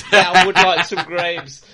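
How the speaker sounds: high, loud, cartoonish eager yes; mock eager victim. Critic parodying a victim, high and loud. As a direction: ham up a dim victim grabbing the bait.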